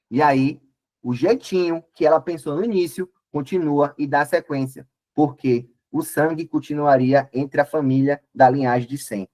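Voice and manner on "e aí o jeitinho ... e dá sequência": ordinary talk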